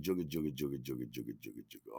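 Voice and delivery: rhythmic chugging, fading away softly; gate rattle imitation. Teller imitating the gate coming down, fading chug. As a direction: imitate a shutter clanking down, dropping in pitch and volume.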